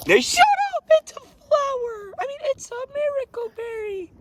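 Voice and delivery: high, shouting outburst; yelling. Acted. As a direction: snap at them in a high yell. Penguin snapping at the others.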